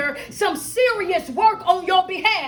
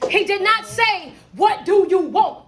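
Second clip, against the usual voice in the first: slow, punched "What do you want", each word separated; pointed emphasis; 4 semitones higher, 5 dB louder, slower than usual. Retold or imitated. Coach stressing what angel never asked Jacob, emphatic. Contrasts the question not asked.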